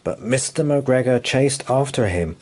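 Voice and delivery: usual speaking voice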